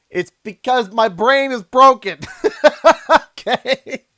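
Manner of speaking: laughing. Real, not acted. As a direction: crack up at yourself. Laughing at own broken brain.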